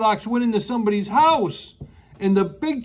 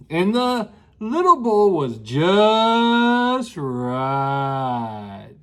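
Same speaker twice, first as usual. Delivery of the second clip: very slow, low and contented; drawn-out satisfied; 5 semitones lower, much slower than usual. Acted. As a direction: stretch it out like pure bliss. Storyteller recaps the perfect bowl.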